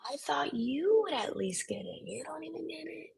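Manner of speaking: high, whispery incredulous imitation; mock hushed disbelief. Mimicking people who expected you to get it, hushed disbelief. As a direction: play someone quietly let down, voice high and tiny.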